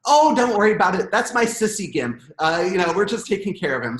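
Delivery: breezy reassurance. Retold or imitated. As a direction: wave it off sweetly. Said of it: "And she goes".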